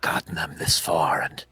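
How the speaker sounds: solemn, knowing spirit whispering a warning; whispering orb spirit. Acted. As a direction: breathe it like a secret only they can hear.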